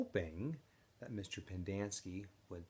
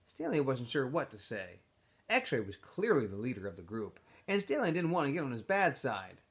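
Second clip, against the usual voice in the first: reading a novel aloud to the class, louder and livelier than the chat; expressive read-aloud of a chapter; story narration; 8 semitones higher, 13 dB louder than usual. A narrator's reading. Teacher reads novel aloud.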